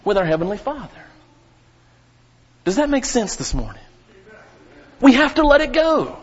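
rising, loud pulpit preaching; fiery preaching. Acted. Pastor warning the congregation about bitterness, fiery preaching. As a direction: build to a fiery pulpit climax.

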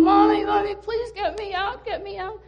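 high, loud sobbing call for mommy; crying plea. Acted. Little girl crying to her mother from the washer, high and tearful. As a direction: beg through tears to be freed. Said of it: "she's crying".

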